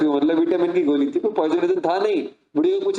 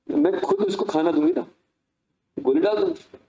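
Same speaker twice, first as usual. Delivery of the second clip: much higher, airy and self-assured; breezy confidence. Acted. A sister assuring her brother she'll slip the pill in, breezy. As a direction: wave off the worry lightly. Sister brushes off his worry.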